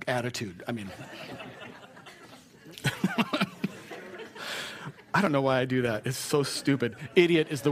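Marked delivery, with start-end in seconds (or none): 2.8-4.9 giggle. Real, not acted. Laughs at own joke.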